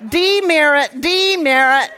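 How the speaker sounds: high, loud playful call; loud, laughing mock reprimand; mock scolding. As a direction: call out a penalty in jest. Playful scolding amid group laughter.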